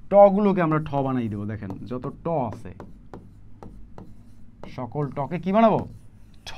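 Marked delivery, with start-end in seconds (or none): none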